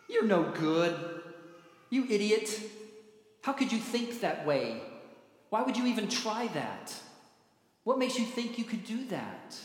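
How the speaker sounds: mock scolding, each jab set apart; hammed-up belittling critic; acted put-down. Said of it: "things that we hear that come out of people's mouths".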